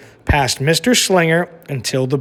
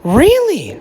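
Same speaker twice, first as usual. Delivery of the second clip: high, louder firm really; proud insistence; 12 semitones higher, 4 dB louder than usual. A narrator's reading. Lily insisting to her parents that it is true.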